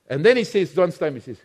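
ordinary talk

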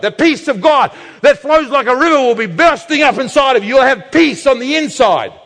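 loud, high preaching cry; a rising shout of joy. Real, not acted. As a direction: proclaim it at full force. Preaching climax about God's peace.

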